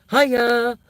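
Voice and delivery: high, loud, cheery ghostly hello; dead mum's greeting. Pretend. Risen mum cheerily greets the barbecue guests, high and loud. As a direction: pop up from the grave all chirpy. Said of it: "walk out the ground and go".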